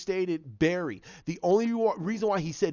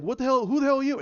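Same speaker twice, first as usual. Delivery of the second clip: startled; 9 semitones higher, 5 dB louder, much faster than usual. Retold or imitated. Heroes startled by newcomer.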